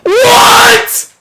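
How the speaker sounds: high disbelieving yell; incredulous shouting. Real, not acted. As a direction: shriek in total disbelief. Disbelief at the plot.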